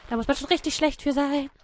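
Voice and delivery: ordinary delivery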